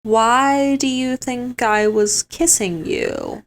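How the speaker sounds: Kane's slow reply. Retold or imitated. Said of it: "is like".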